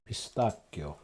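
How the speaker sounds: Pistachio said as pis-TAH-ko with a hard k; pronounced with a K. Shown as a demonstration. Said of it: "read it with a K".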